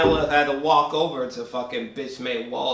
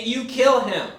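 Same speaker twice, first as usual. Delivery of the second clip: normal talking voice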